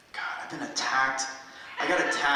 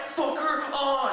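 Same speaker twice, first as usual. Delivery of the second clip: put-on drunk heckler; 11 semitones higher, slower than usual. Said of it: "he was like".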